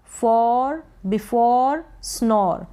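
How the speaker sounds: normal talking voice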